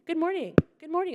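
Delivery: high-pitched perky trainer greeting. Retold or imitated. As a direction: chirp it to the whole gym class. Trainer greeting a gym class.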